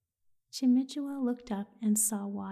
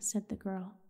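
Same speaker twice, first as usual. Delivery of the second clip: ordinary delivery, nothing marked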